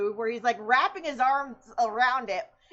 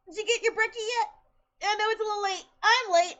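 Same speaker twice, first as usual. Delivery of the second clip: cooing pet talk; 8 semitones higher, 4 dB louder, faster than usual. Real, not acted. Asking the cat about breakfast, high baby talk. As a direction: coo it at the cat.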